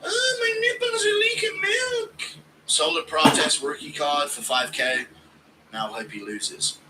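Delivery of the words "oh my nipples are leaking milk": high, slow, crying falsetto whine; whiny Ian impression. Mocking Ian, slow high crying whine. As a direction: wail it like a baby in distress. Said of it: "Impression of Ian having a lactation problem".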